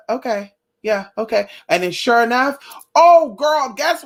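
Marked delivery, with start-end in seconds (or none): none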